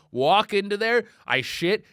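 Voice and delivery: ordinary talk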